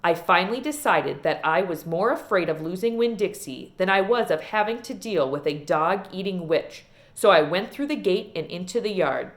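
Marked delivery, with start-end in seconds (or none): none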